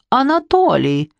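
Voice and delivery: a wife calling her husband to defend her. Acted. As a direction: wail for her husband to step in. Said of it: "возвала брюнетка".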